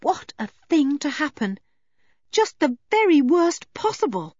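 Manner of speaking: put-on high, anxious girl's voice; high-pitched dismay; dismayed Anne. A narrator's reading. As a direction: lament in a high worried voice. Anne's frightened thoughts.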